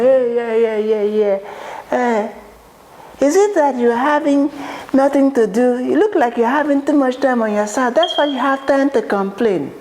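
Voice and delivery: high-pitched impatient boss, cutting in; dismissive interrupting boss. Acted. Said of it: "she cuts me off quickly and she says".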